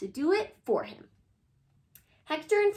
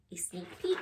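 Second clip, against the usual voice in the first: whisper; 6 semitones lower, 6 dB quieter than usual. Shown as a demonstration. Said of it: "Let's get our brains ready".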